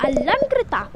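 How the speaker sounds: mother calling her daughter over; mother summons the girl, a mild call. Acted. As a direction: call her name across the house. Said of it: "चिल्ला के बुलाती हैं".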